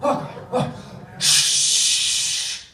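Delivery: whispered okay well, then shh; hushing whisper. Acted. Chief hushing the crowd before his speech, whispered.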